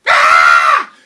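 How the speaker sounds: big, sudden, loud scream; horrified scream. Acted. Mother seeing Jack covered in butter, screaming. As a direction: scream in hammed-up horror.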